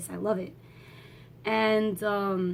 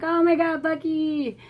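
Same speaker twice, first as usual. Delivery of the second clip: high-pitched, gushing fan cry; imitated fangirl squeal; 7 semitones higher than usual. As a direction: squeal the name like an adoring fan. Acts fan reaction to seeing Bucky.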